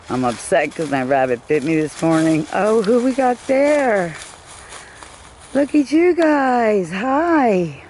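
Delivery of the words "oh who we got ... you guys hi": high, slow cooing to animals. Real, not acted. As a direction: coo at the critters like babies.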